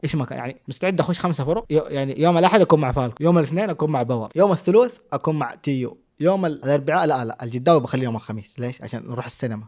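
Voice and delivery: normal talking voice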